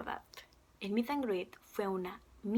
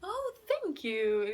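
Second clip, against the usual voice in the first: slow, drawn-out imitation of a British thank you; British accent; 7 semitones higher, much slower than usual. Fan lovingly replaying a British singer's thanks, slow British accent. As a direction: savour the British accent, stretching it out. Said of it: "con ese acento lindo".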